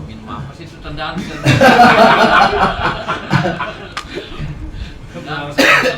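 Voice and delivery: long real laugh; hearty laugh. Real, not acted. Preacher laughs at his own joke, hearty laughter.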